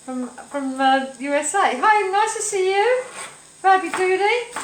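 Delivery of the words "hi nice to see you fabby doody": high, louder cheery hello; effusive high-pitched welcome; gushing greeting. Real, not acted. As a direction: greet a viewer from afar, pitched right up. Calling greeting to American viewer.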